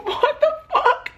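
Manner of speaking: very high squeal of disbelief with a laugh; retelling her reaction to the insult, shrill laughing disbelief; shocked disbelief. Retold or imitated. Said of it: "And I'm like".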